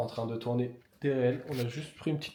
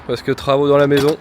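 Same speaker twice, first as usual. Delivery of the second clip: loud announcement; 2 semitones higher, 11 dB louder than usual. Real, not acted.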